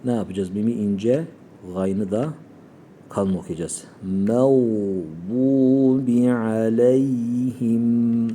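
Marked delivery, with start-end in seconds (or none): 4.3-8.3 slow chanted En'amte aleyhim; slow recitation. Shown as a demonstration. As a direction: recite it long and melodic. Recitation demo.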